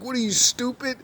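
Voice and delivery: mock a guy laughing someone off; squeaky mocking jeer at the woman; mocking man. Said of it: "he's laughing at her like".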